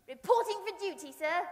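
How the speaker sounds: loud, high, snappy military report; eager soldier report. Acted. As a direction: report like a keen young recruit. Teddy reports to the parson.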